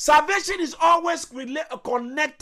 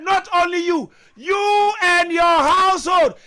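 slow, higher, drawn-out preaching; fervent proclamation; 5 semitones higher, 4 dB louder, slower than usual. Real, not acted. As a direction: stretch each word out in fervour. Preacher's climax, lines drawn out.

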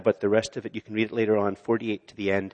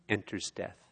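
much softer, almost breathed; near-whispered repetition; 3 semitones lower, 12 dB quieter than usual. Shown as a demonstration. Pastor hushing the congregation on enters death, very soft. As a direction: let the words sink to a hush. Final hushed repeat.

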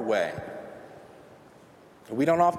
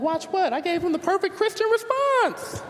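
much higher, defensive disbelief; indignant protest. Retold or imitated. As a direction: squeak it out, offended anyone would question you. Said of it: "And I'm like".

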